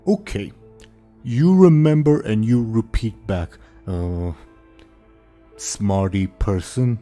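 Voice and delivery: slow, dim-witted ogre bellow; dopey cartoon bellow. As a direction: boom it out like a simple ogre. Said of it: "the ogre says".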